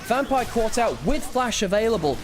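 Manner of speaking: fast-building caster energy; live play-by-play. Acted. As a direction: call the teamfight as it happens.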